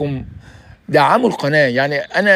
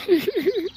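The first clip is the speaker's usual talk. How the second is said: high giggle; 5 dB quieter than usual. Real, not acted. Laughs at own joke.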